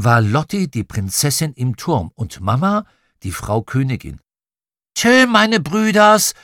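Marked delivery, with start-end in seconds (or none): 5.0-6.3 high, loud, drawn-out farewell; small sister's sing-out shout; little girl calling. A narrator's reading. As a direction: call down slowly from a window, pitched up. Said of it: "rief Prinzessin Lotti".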